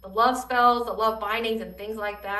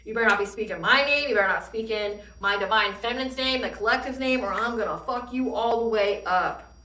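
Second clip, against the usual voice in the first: ordinary delivery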